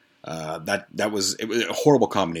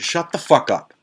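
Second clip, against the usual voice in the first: higher, louder mock yell; retold shout; 8 semitones higher, 5 dB louder than usual. Telling the screaming roommate to shut up: retold shout. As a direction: bark him into silence. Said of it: "So I told him".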